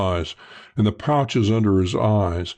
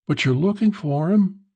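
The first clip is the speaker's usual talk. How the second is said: higher, coaxing woman's voice; young woman voice; 10 semitones higher than usual. A narrator's reading. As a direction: play her sly and curious. Narrator voices Cupie.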